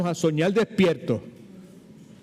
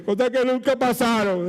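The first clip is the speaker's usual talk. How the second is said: high, pleading lament, raised pitch; the preacher repeats his mournful tone to the congregation; mock lament; 6 semitones higher than usual. Shown as a demonstration. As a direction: wail it like a tragedy. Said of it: "o sea, con ese tono así".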